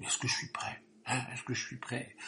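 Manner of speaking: anxious half-whispered self-question; worried whisper. Retold or imitated. Preacher voicing a worried believer, anxious whisper. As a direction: whisper it, fretting you are not ready. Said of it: "d'autres sont soucieux".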